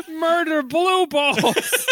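high, slow and laughing. Pretend. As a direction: giggle through the words. Repeats the joke laughing.

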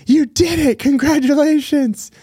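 squealing high with giddy joy; Elf. Retold or imitated. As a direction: gush like an overjoyed childlike elf. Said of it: "he's like".

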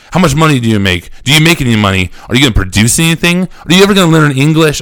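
fast, rising interrogation; rapid-fire questioning. Retold or imitated. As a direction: fire questions without waiting for answers. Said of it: "asking me all these questions very, very fast".

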